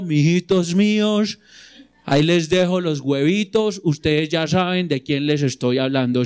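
a hammed-up impression of a famous politician's voice; imitating the ex-president. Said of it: "uno sabe a quién está imitando".

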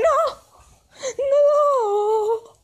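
high, loud panicked no; mock terrified. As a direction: plead in fake terror. Neighbours refusing feather nose.

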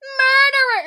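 high, loud crying scream; screamed copy. Retold or imitated. Popping out of the bush to copy Kira's scream. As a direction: shriek it right after her. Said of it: "I pop out of the bush and I'm like".

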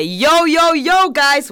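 energetic intro call; hyped show opener. Real, not acted. Host opening podcast to listeners, hyped.

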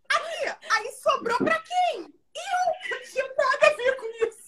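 high, drawn-out whine of protest; exaggerated high-pitched grievance; aggrieved protest. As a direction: plead innocence in a high, hammed-up lament. Retelling being left with the blame.

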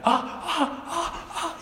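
high shrill-free mock shrieking, laughing through it; child's sudden shrieks. Priest imitating a child shrieking in class, laughing. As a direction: imitate a possessed child crying out, half laughing. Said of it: "de repente empezó a gritar".

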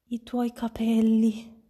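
slow, drawn-out cry of alarm; shocked shriek. A narrator's reading. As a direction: gasp out the words in mild mock horror. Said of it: "strillò lei". (strillo sconvolto, trattenuto)

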